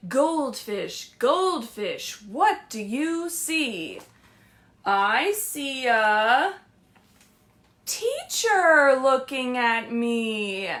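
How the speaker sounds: slow, singsong picture book read; lilting read-aloud to little kids; reading to children. Acted. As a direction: read it like story time.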